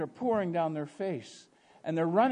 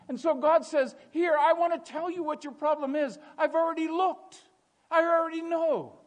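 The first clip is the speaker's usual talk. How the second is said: raised, high-pitched preacher's voice; impassioned preaching; 13 semitones higher, 5 dB louder than usual. Acted. Preacher telling congregation God knows the problem, impassioned. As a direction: press each phrase on the congregation.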